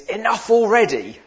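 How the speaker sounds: loud, high mock exasperation; exasperated catchphrase. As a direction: burst out with playful exasperation. Exasperated catchphrase about too many emails.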